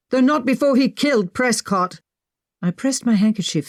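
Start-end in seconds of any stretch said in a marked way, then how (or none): 0.1-2.0 shouting. Acted. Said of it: "Edwin shouted".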